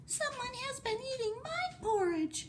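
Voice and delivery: wee, tiny, very high voice; Baby Bear. Acted. Baby Bear finds his porridge eaten, wee tiny voice. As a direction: squeak it as a tiny bear cub. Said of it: "in a wee little tiny voice".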